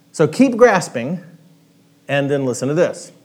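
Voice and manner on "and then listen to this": ordinary talk, nothing marked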